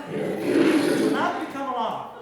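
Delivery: hammed-up shouted reassurance; mock alarm control. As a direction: boom it out to calm a panicking audience. Calming crowd over singer's loud outburst.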